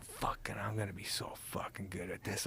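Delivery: whispered self pep talk, no voice. Retold or imitated. Alone at home at night, psyching himself up in a whisper.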